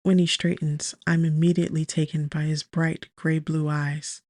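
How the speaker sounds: ordinary delivery, nothing marked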